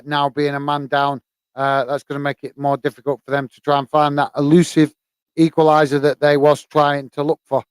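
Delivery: ordinary talk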